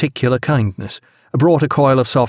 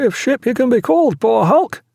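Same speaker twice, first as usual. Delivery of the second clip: ordinary delivery, nothing marked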